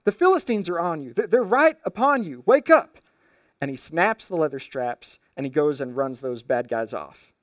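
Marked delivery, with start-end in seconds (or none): 0.1-3.0 high, loud alarmed yell; frantic yell to wake Samson; yelling warning. Retold or imitated. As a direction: shout him awake in a panic. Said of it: "she yells".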